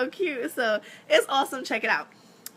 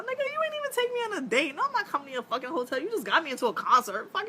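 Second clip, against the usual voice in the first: indignant retort; 8 semitones higher, faster than usual. Retold or imitated.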